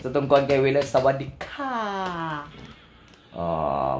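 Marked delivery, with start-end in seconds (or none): 0.9-2.4 slow Thai greeting, long drawn-out ending; mock Thai accent. Host demonstrating a Thai greeting, mock Thai accent. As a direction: hold the last syllable, Thai style. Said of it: "mana mga taga-Thailand".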